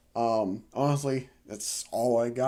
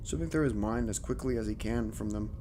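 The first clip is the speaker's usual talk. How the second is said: story narration; 4 dB quieter than usual. A narrator's reading. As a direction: read it like a spooky tale. Reads horror story aloud.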